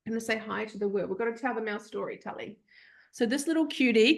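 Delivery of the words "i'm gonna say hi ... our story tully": much softer and quick, coaxing a sleepy pup; softly to the puppy. Real, not acted. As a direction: hush it to the dog in your arms.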